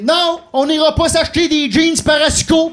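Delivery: high loud refusal; patient yelling. Retold or imitated. Fellow patient yelling into a fake Bluetooth, high and loud. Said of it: "Je l'entends dire".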